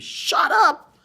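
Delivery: high, loud, exasperated shout; sudden scream. Retold or imitated. Woman to the mother who cried for thirty minutes, sudden scream. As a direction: scream it out of nowhere. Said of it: "she just screamed".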